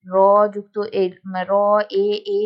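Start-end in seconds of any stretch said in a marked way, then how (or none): 1.5-2.4 র, then এ, এ each held long; drawn-out vowel. Shown as a demonstration. Teacher breaking রে apart to show the drawn-out vowel. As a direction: split the syllable and stretch each এ. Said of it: "এরকম করে অনেকটা টেনে উচ্চারণ করি".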